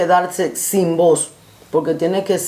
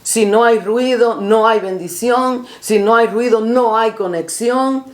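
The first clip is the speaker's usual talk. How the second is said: preaching; 4 semitones higher than usual. Acted. Preaching rising.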